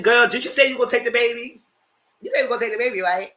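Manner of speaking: loud retold needling; loud teasing. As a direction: press her loudly, half teasing.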